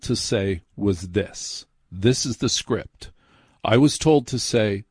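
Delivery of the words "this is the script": ordinary delivery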